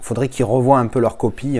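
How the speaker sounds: usual speaking voice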